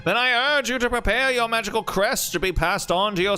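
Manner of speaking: put-on booming old newsreel announcer; old-timey radio announcer. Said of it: "give him the old-timey radio announcer voice".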